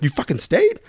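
acted-out disbelief, pitch jumping up; incredulous. Talking to the jailed buddy who didn't run, incredulous.

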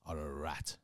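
low, grave stage-actor insult; thespian. Acted. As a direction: deliver it like an old-school theatre line. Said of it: "You say it like a thespian".

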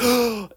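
sharp high mock gasp of outrage; mock offended gasp. Mocking snobby friends who judge enjoyment, high affronted gasp. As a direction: gasp like a scandalised snob. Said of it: "they're just like".

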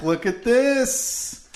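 high, slow, drawn-out delight; excited. Real, not acted. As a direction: savour the moment, high and slow. Showing a check excitedly.

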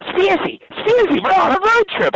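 mocking own high skit voice; high-pitched. Shown as a demonstration. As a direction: squeak it way up high, self-parody. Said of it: "like, high pitched".

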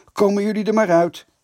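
loud, high, quick summons; calling inside. A narrator's reading. Keeper calls the girls out of the school, shouting. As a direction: holler for them to come out. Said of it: "riep hij naar binnen".

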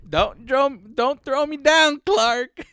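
put-on high, loud, slow old-lady plea; cute old aunt voice. Imitating Aunt Bethany pleading not to be thrown down. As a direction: squeak it out like a sweet senile aunt. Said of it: "'Cause she says it so cute".

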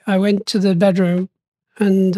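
ordinary delivery

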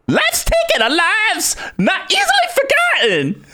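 very high-pitched voice of a ripped tough guy; Koth. Acted. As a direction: squeal it out like an over-the-top comic impression. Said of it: "like, this high voice Saskatchewan guy".

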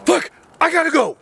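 urgent son. Retold or imitated. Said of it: "And Brian says".

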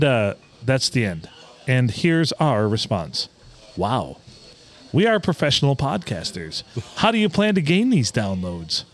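usual speaking voice

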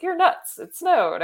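incredulous. Retold or imitated. Retold reply to husband.

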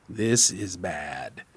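ordinary delivery, nothing marked

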